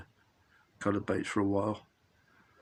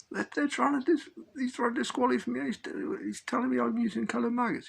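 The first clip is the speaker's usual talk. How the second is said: very high-pitched retold complaint; mock protest; 13 semitones higher than usual. Accused angler complains to his friend, high mock protest. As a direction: plead your case in a comically high voice.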